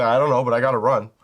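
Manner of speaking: fast, loud frantic dog voice; a hyper dog blurting it out in a rush; voicing the dog. Dog owner voicing his hyper dog, rushed and loud. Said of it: "She's like".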